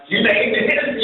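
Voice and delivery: high, amazed question; surprised. Retold or imitated. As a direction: act amazed at the video. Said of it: "And I'm like".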